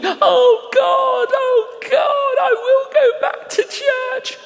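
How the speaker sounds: loud, high-pitched wailing cry, hammed up; weeping cry. Driver weeping as he is prayed for, imitated loud wail. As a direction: imitate a man bawling out to God at full voice. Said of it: "He's weeping. And he's going".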